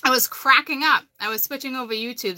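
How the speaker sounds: ordinary delivery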